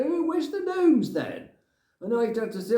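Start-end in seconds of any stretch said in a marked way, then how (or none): 0.0-1.5 high, slow mock wife asking; imitating wife. Wife coming home asking where the gnomes went, high and slow. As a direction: pitch way up like her asking sweetly. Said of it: "she'd go".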